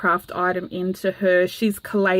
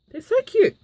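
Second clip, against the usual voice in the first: excited squeal; 12 semitones higher than usual. Real, not acted. Gushing over gifted tickets.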